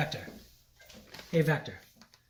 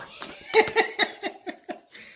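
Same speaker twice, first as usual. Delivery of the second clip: laughing. Real, not acted. Robot crosses the tape border.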